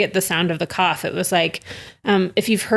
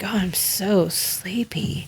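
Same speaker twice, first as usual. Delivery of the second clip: sleepy; 5 dB quieter, slower than usual. Retold or imitated. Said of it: "The sleepy, she's like".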